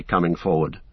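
plain reading voice

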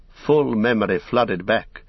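ordinary delivery

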